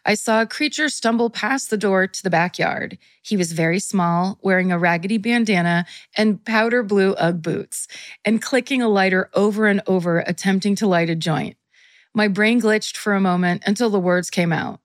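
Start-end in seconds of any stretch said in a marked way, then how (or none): none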